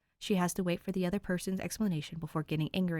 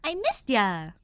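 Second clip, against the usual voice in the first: excited greeting; 6 semitones higher, 7 dB louder, slower than usual. A narrator's reading. Cheerful greeting to Dave.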